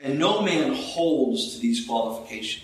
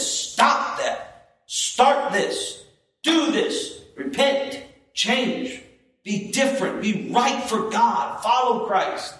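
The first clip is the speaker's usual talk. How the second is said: high-pitched string of urgent commands; emphatic preacher's reprimand; sharp rebuke; 7 semitones higher, slower than usual. Shown as a demonstration. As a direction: hand down orders one by one, sharply. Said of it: "Rebuke them sharply".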